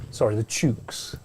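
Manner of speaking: put-on a half-hearted, slow stab at an Australian accent; bad Australian accent. Teasing his co-commentator about his chickens, in a bad Australian accent. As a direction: try an Australian twang and miss. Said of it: "Don't know what accent that was".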